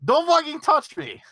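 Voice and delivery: high, loud mock yell; retold yell. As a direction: yell it out as if retelling an outburst. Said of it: "I turn around and I yell at him".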